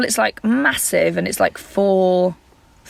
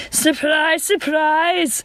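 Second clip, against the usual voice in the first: high, over-the-top Scouse catchphrase; Scouse accent; 9 semitones higher, much slower than usual. Retold or imitated. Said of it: "So she'd go".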